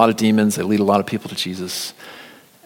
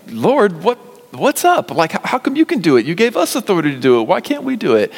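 much higher, fast, bewildered pleading; baffled disciples; 11 semitones higher, 6 dB louder than usual. Retold or imitated. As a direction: whine it as confused followers. Disciples baffled after demon cast out.

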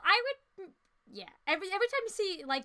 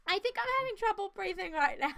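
ordinary delivery

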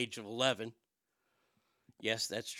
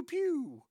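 high squeaky pew of a toy blaster; toy gun pew; 12 semitones higher than usual. Retold or imitated. Kids playing war with block guns, high toy laser pew. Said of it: "that can shoot and go pew".

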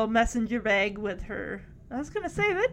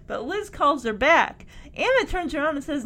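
usual speaking voice

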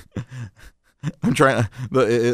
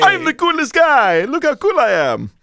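high mock Italian accent; Denzel Washington; 6 semitones higher than usual. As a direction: boast in a silly bad Italian accent. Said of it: "Give us a Denzel Washington".

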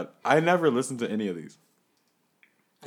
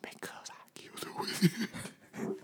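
whisper; 6 semitones higher, 8 dB quieter, slower than usual. Acted. Whispered joke.

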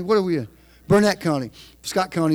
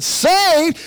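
surprised echo; 10 semitones higher, 5 dB louder than usual. Retold or imitated. Narrator's surprised reply.

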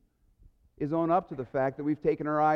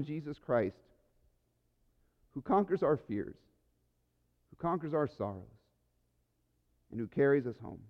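soft, lower prayer voice; closing prayer; 7 semitones lower, 5 dB quieter than usual. Acted. Pastor leading the church in a soft, lower closing prayer. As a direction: pray it gently over the congregation.

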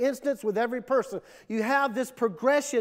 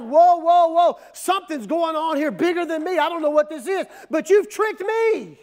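raised, louder voice of startled alarm; alarmed disbelief; 5 semitones higher, 7 dB louder than usual. Acted. Woman realising she has been tricked, acted alarm. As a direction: back off in alarm, building to an accusation.

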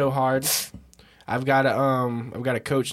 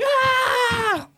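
yell; 6 dB louder than usual. Retold or imitated. Retold reaction, sudden yell.

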